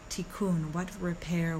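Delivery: ordinary delivery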